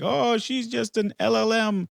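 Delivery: high-pitched, dismissive imitation of an online critic; put-on scoffing objection; mock fired-up commenter. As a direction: mimic an indignant commenter, pitched well up. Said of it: "get fired up in the comments".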